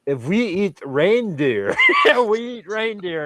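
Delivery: put-on slow, halting try at a Swedish accent; half-hearted Swedish accent with a laugh mid-line; attempted Swedish accent. Retelling what the Swedish girl said, in mock Swedish accent. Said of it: "I can't do the Swedish accent".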